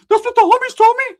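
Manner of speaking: loud falsetto protest; mock defensive cousin. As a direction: squeal it like a doubted cousin insisting. Imitates cousin defending his story.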